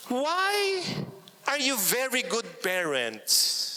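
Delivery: high "why", then a slow wondering question; a hint of teenagers asking their parents in wonder; kids asking. Teenage children asking their parents, high and slow. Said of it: "sabi nila".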